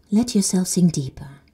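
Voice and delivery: whispered sinking cue; soft whispered instruction. Acted. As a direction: let it drift out as a whisper. Whispered cue while exhaling.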